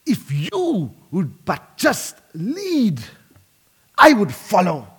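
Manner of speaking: put-on slow, pleading Scottish accent; a preacher's earnest attempt at a Scottish accent. Wallace pleading with James the Bruce to lead, in a Scottish accent. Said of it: "Do my best to do a Scottish accent".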